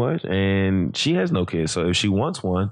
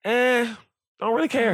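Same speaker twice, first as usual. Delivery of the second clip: put-on much higher, dismissive kid voice; indifferent daughter; 14 semitones higher than usual. Daughter dismissing the school dance, imitated high-pitched indifference. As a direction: imitate a girl shrugging it off.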